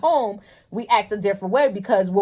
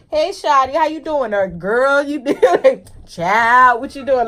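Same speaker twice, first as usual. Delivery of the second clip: higher, lively greetings to coworkers; casual work greetings; 5 semitones higher, 5 dB louder, slower than usual. Retold or imitated. Greeting coworkers casually at her job, lively higher voice. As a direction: greet your friends at work loosely. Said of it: "So now I go in there and it's".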